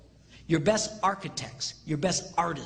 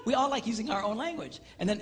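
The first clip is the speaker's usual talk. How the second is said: ordinary talk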